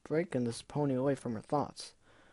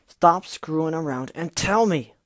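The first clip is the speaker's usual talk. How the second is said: loud, raised furious demand; angry yell; 3 semitones higher, 8 dB louder than usual. Acted. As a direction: yell it at her. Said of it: "flinched at the volume of Gilda's voice".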